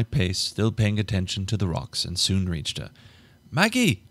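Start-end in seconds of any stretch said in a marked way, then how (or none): 3.5-4.0 much higher calling out her name; high loud call; yell. Acted. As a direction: shout her name after her. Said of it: "I yelled".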